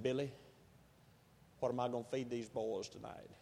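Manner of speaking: soft, low voice of a worn-out mother; quiet despair. Retold or imitated. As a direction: let the words fall quietly, with no hope left.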